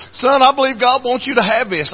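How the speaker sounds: high, tearful retelling voice; imitated sobbing. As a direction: speak high, as if through comic sobs. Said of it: "this businessman's a sobbing".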